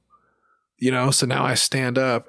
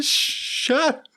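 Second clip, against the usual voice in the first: squeaky falsetto. Retold or imitated. Imitating the breathless squeal.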